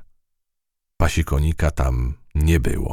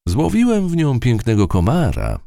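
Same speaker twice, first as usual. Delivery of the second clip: boasting; 9 semitones higher, 3 dB louder than usual. A narrator's reading. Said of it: "pochwalił się".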